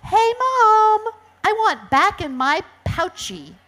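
put-on high, loud child's call to mom; shouted. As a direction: call out like an excited baby animal. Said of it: "Then she shouted".

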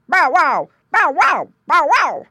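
loud high bow wow barks; barking dog. A narrator's reading. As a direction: bark out a warning. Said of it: "barked Dog".

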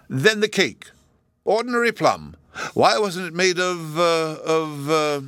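high, petulant royal voice, stumbling over words; a spoiled emperor sputtering complaints; peevish emperor. A narrator's reading.

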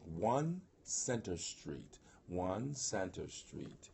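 measured, careful public announcement; slow announcing. Real, not acted. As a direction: address given very slowly and deliberately. Announcing the venue address.

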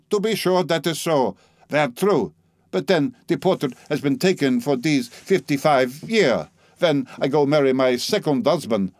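a proud old Frenchwoman mocking him in her broken English; French-accented old lady. Old Frenchwoman mocking the narrator, French accent.

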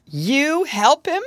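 high, loud, drawn-out incredulous question; mocking disbelief. A narrator's reading. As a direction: scoff aloud at the very idea. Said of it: "cried all the group".

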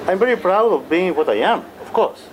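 usual speaking voice

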